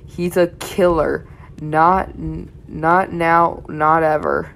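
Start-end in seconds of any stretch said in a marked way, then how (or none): none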